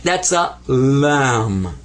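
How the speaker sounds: "lam" very slow and clear; naming lam. Shown as a demonstration. Teacher introduces the Arabic letter lam. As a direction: draw out "lam" for the class. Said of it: "this is called lam".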